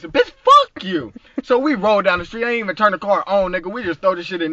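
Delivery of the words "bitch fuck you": slow, drawn-out mock defiance; defiant retort. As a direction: spit it out slowly at the neighbor. Retort to neighbor's police threat.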